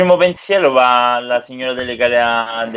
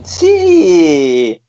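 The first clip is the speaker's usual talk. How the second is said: loud, drawn-out triumphant yes; real delighted cheer; gleeful yes; 3 semitones higher, 6 dB louder than usual. Real, not acted. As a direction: celebrate it loudly, stretching the word. Player cheers at own overflow.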